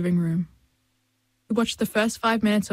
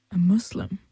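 a hushed, unvoiced secret; whispered like gossip behind a hand. A narrator's reading. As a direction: cup the phone and whisper it. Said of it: "as she whispered".

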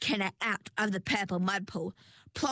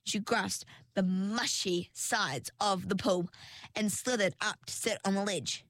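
ordinary delivery, nothing marked

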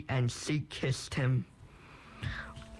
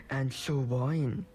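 ordinary delivery

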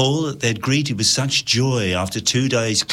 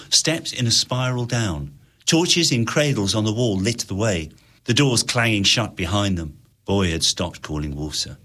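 usual speaking voice

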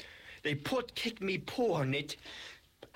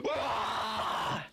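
long, raised-pitch monster roar; angry roar; 4 semitones higher than usual. Acted. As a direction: roar in play anger. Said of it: "Blonde roared in anger".

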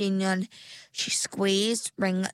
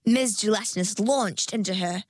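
usual speaking voice

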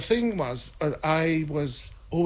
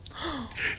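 short shocked intake of breath, soft; mock gasp; 10 semitones higher, 7 dB quieter than usual. Her date seeing her cigarette and drink, a soft mock gasp.

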